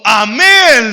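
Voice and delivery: a loud, booming "Amén" shouted across the room; shouted amen. Retold or imitated. In math class, a teen shouts church "Amén" at the teacher. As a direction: blurt "Amén" at full volume, automatically. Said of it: "le grité del fondo".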